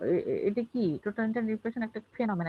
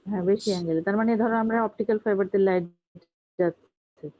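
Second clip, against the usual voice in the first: loud emphasis; 7 dB louder than usual. Real, not acted. Teacher confirming correct answer loudly.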